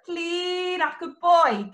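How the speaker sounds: ordinary talk, nothing marked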